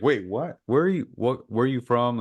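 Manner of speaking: high, baffled questions; mock bewildered asking; puzzled surprise. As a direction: play the confused locals trying to place her. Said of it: "They're like".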